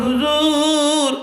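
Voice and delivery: very slow, high হুজুর; drawn-out high call. Acted. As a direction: stretch হুজুর long and high. Boy speaks into his ear. (দীর্ঘ টানা উঁচু স্বরে হুজুর)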